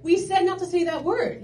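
high-pitched, softened reprimand; scolding babysitter. Retold or imitated. Babysitter to swearing boy, mock-stern scolding. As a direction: pull the child up on the name, then explain.

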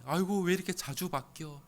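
high, loud whine about changing laws; fed-up sigh of complaint; grumbling parent. Retold or imitated. Parent griping about school laws, mock whine. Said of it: "이렇게 생각할 수도 있겠죠".

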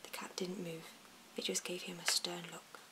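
ordinary delivery, nothing marked

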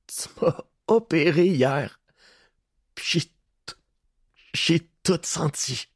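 broken prisoner tells what he felt; pained accusation. A narrator's reading. Prisoner accuses his torturer.

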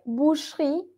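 "boucherie" as "boosh-ree", two beats; silent middle E. Shown as a demonstration. Teacher shows the difficult part, middle E dropped. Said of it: "no diríamos".

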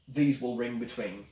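ordinary talk

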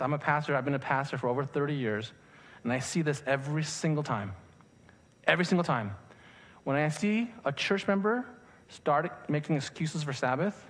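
usual speaking voice

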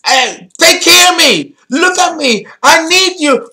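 put-on high-pitched, needy baby-like demands; angry, demanding Aries. Astrologer voicing Aries moon energy, demanding and needy. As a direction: plead up high, insisting on attention. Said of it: "it's angry, demanding energy".

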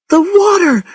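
very high, loud cry of alarm; screaming in panic. A narrator's reading. Woman swimming as hot gas explodes, panicked cry. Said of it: "She screamed".

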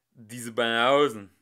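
angry mutter. Acted. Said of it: "murmelte er mit geballter Faust".

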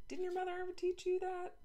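high, prissy know-it-all question; snooty question. Acted. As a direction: talk down to her from way up high. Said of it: "the girl asked".

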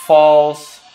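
usual speaking voice

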